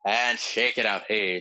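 normal talking voice

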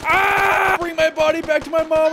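loud, high mock-heroic cries; hammed-up heroic shout. Player charging into battle as a doomed hero, loud hammed-up shouting.

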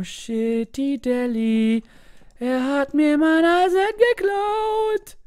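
high slow whining complaint; whiny mock voice. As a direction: whine like a wronged child.

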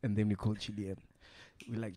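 ordinary delivery

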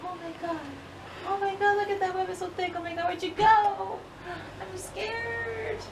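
high, frightened voice; scared. Real, not acted. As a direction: say it terrified. Frightened by spider web.

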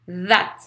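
"That" with a voiced th; buzzing th. Shown as a demonstration. Teacher drilling the vibrating th, "That" with a buzzed start. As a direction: say "That" starting on a Z-like buzz between the teeth. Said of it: "mais vibrant".

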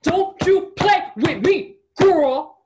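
loud, high, slow warning; acted menacing shout, word by word; imitated threatening partner. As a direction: punch out each word as a threat. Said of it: "His favorite thing to say".

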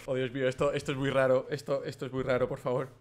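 muttered disbelief. Real, not acted. Plugging a controller into the doll, softly appalled. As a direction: mumble it, weirded out.

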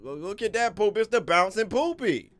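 high, loud grossed-out squeal; real squeal of disgust at the poop; disgusted shriek. Real, not acted. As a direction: shriek at the gross bouncing thing.

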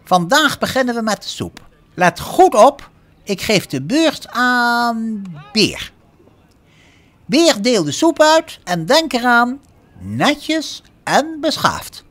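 high, loud bossy instructions; stern teacher addressing a crowd at volume; strict cookery teacher. A narrator's reading. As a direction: order the class about, loud and high. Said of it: "Ze was wel streng".

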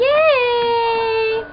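high excited cheer. Real, not acted. Cheering at end of video.

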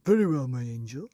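fake-sweet scheming husband; Mr Twit's false sweetness. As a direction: say it with a ghoulish grin. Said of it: "said Mister Twit".

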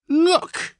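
put-on very high, loud quavering old voice. A narrator's reading. Old man showing the garment, quavering. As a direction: let the voice wobble. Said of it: "he quavered".

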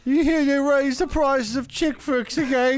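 high, slow mock Cockney gossip; hammed-up Cockney villager; Cockney accent. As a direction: drag out a silly Cockney question. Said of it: "doing the Cockney accent".

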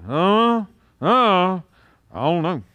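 mock indecisive kids, long uhs; dithering children. Preacher mimics his children choosing where to eat, mock dithering. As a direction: imitate children stalling with a trailing answer. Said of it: "like my children trying to figure out".

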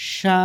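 সা held as a long "sha"; drawn-out vowel. Shown as a demonstration. Quran teacher showing one-alif length, সা drawn out. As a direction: stretch সা out evenly. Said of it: "এগুলো টেনে পড়তে হবে একালিফ পরিমাণ".

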